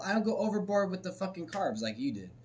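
normal talking voice

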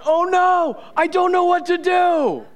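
high-pitched, hammed-up panic; comic high-voiced dithering; mock freaking out. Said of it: "freaking out going".

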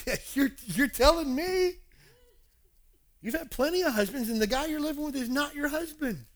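high-pitched, teasing retort; amused disbelief. Retold or imitated. Jesus telling woman about her husbands, amused. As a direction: call it out in mock incredulity.

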